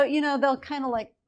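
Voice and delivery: ordinary talk, nothing marked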